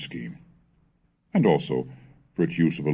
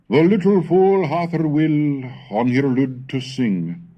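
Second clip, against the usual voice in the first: lyrical recital, higher and louder at the start, then settling; poem recitation; 7 semitones higher, 3 dB louder than usual. Acted. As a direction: recite it like a spring love song. Recites the Middle English poem.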